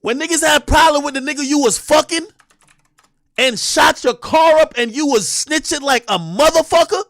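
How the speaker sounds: angry streamer yelling at a rapper's claims; heated rant. Real, not acted. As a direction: shout her down, fired up.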